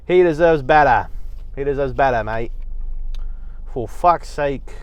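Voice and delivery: rough attempt at an Australian accent. Ranting at fight bookers for a snubbed boxer, in a put-on Australian accent. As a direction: say it like a scolding Aussie mate. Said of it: "used to do an Australian accent".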